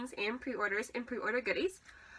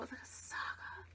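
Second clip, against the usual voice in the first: whispering; 14 dB quieter than usual. Real, not acted. Whispered aside.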